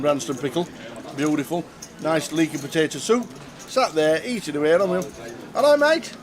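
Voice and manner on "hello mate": "Hello, mate" in a mistaken put-on accent, much higher; mock-voicing a man with the wrong accent. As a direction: slip into the wrong voice for the bloke at the bar. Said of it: "wrong accent".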